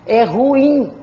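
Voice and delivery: "ruim" said loudly as ru-IM; stress on final syllable. Shown as a demonstration. Teacher repeating an oxytone for students, strong stress on "im" in "ruim". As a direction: punch the "im" of "ruim". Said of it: "Sílaba tônica é a última".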